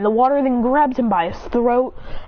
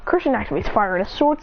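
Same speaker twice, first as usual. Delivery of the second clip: ordinary delivery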